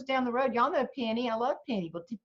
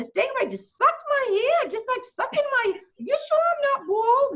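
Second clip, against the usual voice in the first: much higher and louder, breathless gossip; chattering Yankee; 4 dB louder than usual. Retold or imitated. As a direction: squeal about the stingrays. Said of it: "She said".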